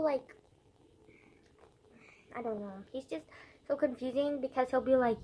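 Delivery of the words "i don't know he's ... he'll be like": ordinary talk, nothing marked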